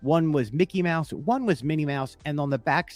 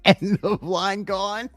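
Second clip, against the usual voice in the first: higher, slow echo of his line; imitating the exit line; 6 semitones higher, slower than usual. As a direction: lightly mimic the cohost's exit. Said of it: "That's brilliant".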